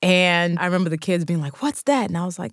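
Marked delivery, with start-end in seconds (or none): 1.6-2.1 put-on high, puzzled child asking; a lightly voiced schoolkid, high and curious; curious kid voice. Kids question her lunch.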